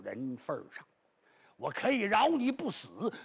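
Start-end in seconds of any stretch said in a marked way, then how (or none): none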